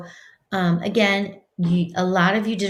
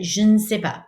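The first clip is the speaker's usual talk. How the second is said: normal talking voice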